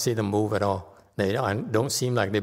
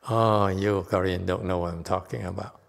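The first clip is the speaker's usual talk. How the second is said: normal talking voice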